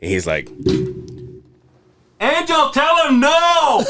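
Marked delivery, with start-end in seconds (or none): 2.2-3.8 high mock shout of the uncle; slow, high-pitched imitation of the uncle calling out; uncle yelling. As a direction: yell it like the bossy uncle. Uncle yelling at speaker to refuse.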